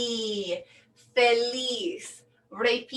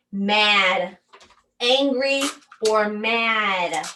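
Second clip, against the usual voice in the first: ordinary talk, nothing marked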